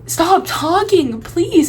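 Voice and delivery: high, loud, drawn-out begging; exasperated plea. Retold or imitated. Pleading with annoying friends, loud and exasperated. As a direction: stretch it out, pleading and fed up. Voices her fed-up inner plea.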